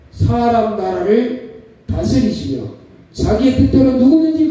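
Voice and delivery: high, loud, slow preaching of the verse; preacher declaiming Daniel from the pulpit; proclaiming scripture. Acted. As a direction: thunder the verse out over the congregation.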